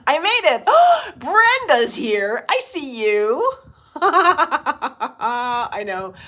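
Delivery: high loud delighted hello; gasping, laughing welcome to viewers; excited greeting. Real, not acted. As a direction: greet arriving friends with delight.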